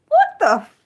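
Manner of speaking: sudden high cry of shock, cut off; startled outburst. Real, not acted. Streamer reacting to a wild name in chat, startled high cry. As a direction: catch yourself mid-curse in shock.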